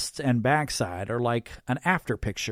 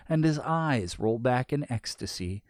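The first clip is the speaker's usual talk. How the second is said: ordinary delivery, nothing marked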